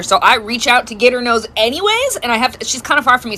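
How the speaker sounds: ordinary talk, nothing marked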